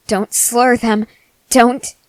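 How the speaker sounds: ordinary talk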